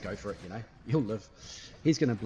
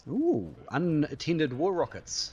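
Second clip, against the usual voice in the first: slow delighted ooh; 6 semitones higher, 4 dB louder, much slower than usual. Real, not acted. Spotting loose war rockets, drawn-out delight. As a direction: stretch the ooh, pleased.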